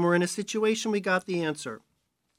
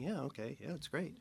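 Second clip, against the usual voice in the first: much softer, half-hearted yeah; unenthused agreement; 3 semitones lower, 15 dB quieter than usual. Retold or imitated. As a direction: mumble along politely, unimpressed. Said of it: "And we're all going".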